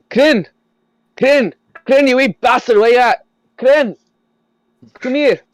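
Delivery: high, loud shouting across the building; shouting for Kryn. Acted. Old Weejan yelling for his goblin servant, loud high shout. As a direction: bellow the goblin's name again and again, high and loud. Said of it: "you hear him shout".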